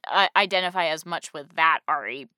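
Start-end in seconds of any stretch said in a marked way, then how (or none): none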